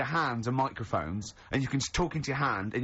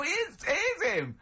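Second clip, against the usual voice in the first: hammed-up thrilled onlooker, much higher; squeaky gasping recognition voice, pitched way up; excited bystander. Bystanders spot Frog Boy.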